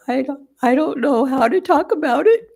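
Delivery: put-on a child choking out words between sobs; through her tears. Granddaughter weeping to her grandmother, tearful and pleading. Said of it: "through her tears".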